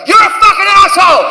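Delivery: high, furious outburst in his face; angry shout. Acted. Karen confronts the comedian up close, angry shout. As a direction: yell it as a sudden insult.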